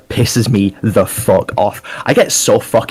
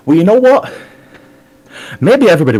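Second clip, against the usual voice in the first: defiant outburst; 8 semitones higher, faster than usual. Acted. Defiant promo turn.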